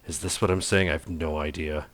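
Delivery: usual speaking voice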